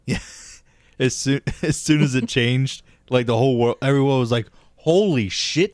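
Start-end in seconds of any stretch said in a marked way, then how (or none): 4.8-5.7 slow, higher-pitched stunned disbelief; hammed-up awe, drawn out slowly; mock astonishment. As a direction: act floored by what you see. Acting out everyone seeing colour.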